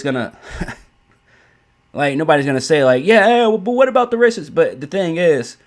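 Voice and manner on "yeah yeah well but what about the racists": mocking whiny retort. Mocking an imagined critic's retort.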